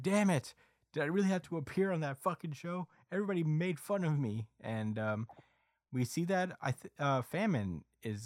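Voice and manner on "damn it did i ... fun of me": high, whining mock complaint; frustrated kid. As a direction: imitate an embarrassed kid protesting, pitched up. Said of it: "is like".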